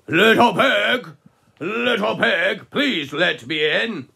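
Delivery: slow, loud wolf at the door; big bad wolf. Wolf calling to the piggies inside, slow and loud. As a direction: draw out each word like a sly wolf.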